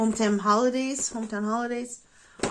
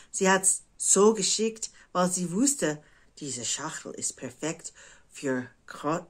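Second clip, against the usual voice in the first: usual speaking voice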